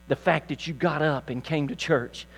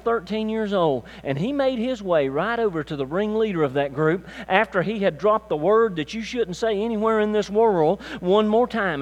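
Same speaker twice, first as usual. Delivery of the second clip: ordinary delivery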